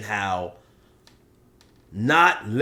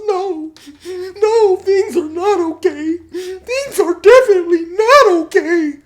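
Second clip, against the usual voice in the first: hammed-up bawling in loud falsetto; [name]; 10 dB louder than usual. Upset inmate answering his buddy, exaggerated falsetto bawling. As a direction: sob it out very high, building louder. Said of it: "[name] is crying and stuff".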